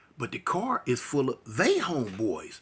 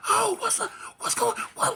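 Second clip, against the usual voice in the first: very high panicked screech; screaming in panic; 3 dB louder than usual. Retold or imitated. Girlfriend screaming as the car speeds off, high panic. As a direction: shriek it like she's terrified. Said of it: "she's screaming and hollering".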